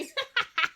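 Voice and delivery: a high, bright burst of laughter; high amused laugh. Real, not acted. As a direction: laugh out loud at the dog. Laughing at dog.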